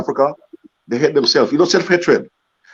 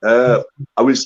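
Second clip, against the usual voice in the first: normal talking voice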